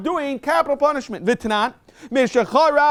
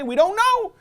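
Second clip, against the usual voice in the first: quoted witness; 10 semitones higher, slower than usual. Retold or imitated.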